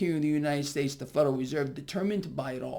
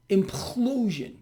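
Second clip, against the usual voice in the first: implosion drawn out slowly and hit high; slow, heavy stress on implosion; stressed word; 4 semitones higher, slower than usual. Real, not acted. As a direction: lean hard on implosion, stretching it out. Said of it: "I can't stress that word enough".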